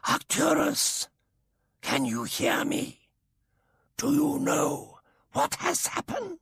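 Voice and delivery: slow high alien asks the injured Arcturus. Acted. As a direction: call out in a thin high alien tone. Alien voice calling patient.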